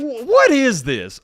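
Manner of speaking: slow, high, stunned what is this; can't believe what he's reading; disbelief. Real, not acted. Reading a creepy comment to viewers, stunned disbelief.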